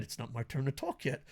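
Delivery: very soft, low self-reminder; muted inner voice. Acted. Host acting out waiting during clips, hushed. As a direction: say it under your breath to yourself. Acts own silent waiting thoughts.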